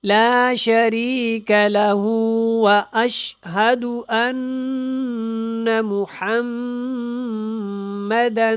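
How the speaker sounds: slow, drawn-out recitation with clear letters; careful tajweed recitation. Shown as a demonstration. Teacher demonstrating the kalima to learners, slow tajweed recitation. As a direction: recite slowly, stretching each word. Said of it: "میں اس کو پڑھتا ہوں".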